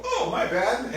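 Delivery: light, high laughing apology; easy apology. Retold or imitated. Partner cheerfully owning the mistake, laughing. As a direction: toss off a breezy sorry. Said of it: "a chance for them to go".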